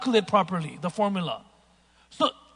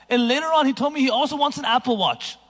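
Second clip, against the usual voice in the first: ordinary talk, nothing marked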